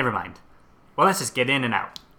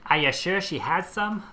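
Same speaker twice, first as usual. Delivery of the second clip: a touch of nerdy 1920s gangster; 6 semitones higher than usual. Acted. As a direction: lightly play a nerdy movie gangster. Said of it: "nerdiest gangster in ... a movie or something".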